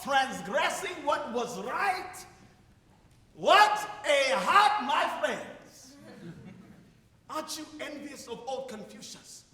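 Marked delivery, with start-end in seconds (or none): 0.0-6.0 slow, building to a shout; preaching. Acted. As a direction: preach it, then cry out. Preaching with rising force.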